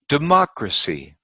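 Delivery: democracy with MOC high and stressed; democracy, stressed on the second syllable; stress on third-last syllable. Shown as a demonstration. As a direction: lift the MOC in democracy, then fall away. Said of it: "third syllable from the end".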